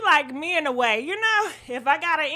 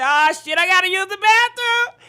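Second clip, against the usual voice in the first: panicked; 5 semitones higher, 8 dB louder than usual. Retold or imitated. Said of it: "So I said".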